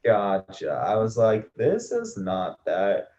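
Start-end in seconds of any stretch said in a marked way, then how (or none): 1.5-3.1 yawning. Real, not acted. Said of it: "Sorry, I'm yawning".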